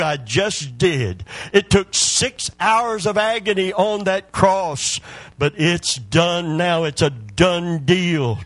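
a rousing sermon climax, loud and high; preaching at full force. Acted. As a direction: drive it home to the congregation, building up.

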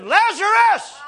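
high, loud shout of a name; calling out. Retold or imitated. Jesus calling the dead man from the tomb, commanding shout. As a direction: call him out at full voice. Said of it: "And he said".

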